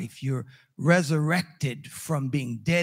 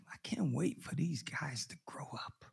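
confiding aside; 3 semitones lower, 12 dB quieter than usual. Retold or imitated. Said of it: "I would say to Yvonne".

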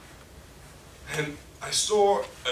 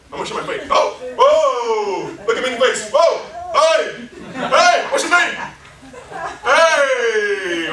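loud, laughing pleas to a stranger; eager street evangelist; 7 semitones higher, 9 dB louder than usual. Acted. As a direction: chase a passerby down, begging him to stop. Acted street evangelism, chasing someone.

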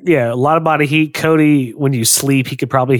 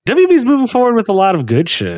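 hyped up; 8 semitones higher, faster than usual. Real, not acted. Hyped repeat of good news.

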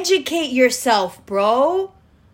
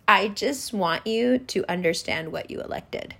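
ordinary talk, nothing marked

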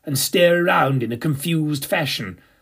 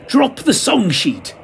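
slower, higher called command; yelled order; 7 semitones higher, 3 dB louder, slower than usual. Acted. Narrator yelling to the stage manager, loud and slow. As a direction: call it across to the wings. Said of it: "and yelled".

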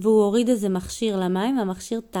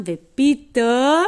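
loud, drawn-out storyteller build-up; dramatic suspense; 4 semitones higher, 7 dB louder, much slower than usual. A narrator's reading. Storyteller just before the device beeps, dramatic suspense. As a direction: stretch the word out big for suspense.

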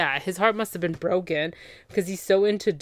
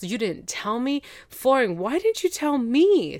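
high, pleading accusation; mock upset; 6 semitones higher than usual. Upset woman confronts him over the secret, mock hurt. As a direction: rise into a hammed-up hurt protest. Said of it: "she's so upset".

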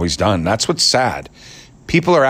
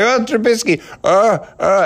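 groveling media guy; 13 semitones higher than usual. Retold or imitated. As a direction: swoon over Trubisky like an obsessed fan. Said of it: "I start going".

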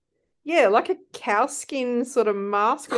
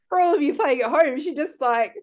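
normal talking voice

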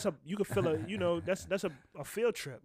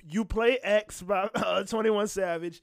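loud; 5 semitones higher, 6 dB louder than usual. Real, not acted. Teasing friend about song picks.

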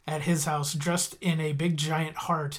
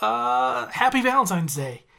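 blurting out; 6 semitones higher, 3 dB louder than usual. Retold or imitated. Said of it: "he just blurts out".

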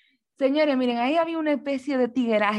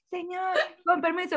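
higher, teary pleading; girl begging a stranger, teary; tearful plea; 6 semitones higher, 4 dB quieter than usual. Retold or imitated. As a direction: beg politely, close to tears. Pleading near tears.

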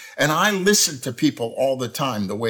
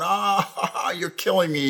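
higher, put-on cracking up; mock laughing; 5 semitones higher, slower than usual. Preacher acting out a listener reacting to something funny, mock laughing. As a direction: act out laughing at someone's joke. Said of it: "says something funny, you're going".

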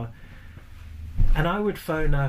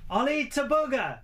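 mock shout; 10 semitones higher than usual. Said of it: "Mo will shout out to the bar".

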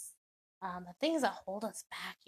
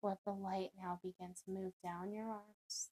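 guided meditation; 7 dB quieter than usual. Acted. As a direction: ease the listener down into calm, unhurried.

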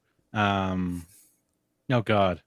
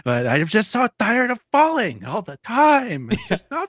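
Data's whiny rant; 10 semitones higher than usual. Retold or imitated. Fan imitates Data whining about always falling, high and whiny. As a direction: whine like a fed-up little kid. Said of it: "he's just like".

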